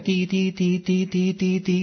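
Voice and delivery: high, steady monotone beeps; imitated busy tone. Life keeps calling and the line is busy, imitated busy tone. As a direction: beep like an engaged phone line.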